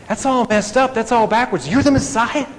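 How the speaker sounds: high, loud astonished outburst; he can't believe it's backwards; excited protest. Retold or imitated. John the Baptist protests to Jesus, high and astonished.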